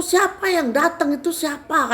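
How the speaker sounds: high-pitched angry questioning; marah. Retold or imitated. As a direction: demand who has come, acting the angry spirit. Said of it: "marah, terus dia tuh bilang begini". (bertanya dengan marah, suara tinggi)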